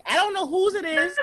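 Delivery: usual speaking voice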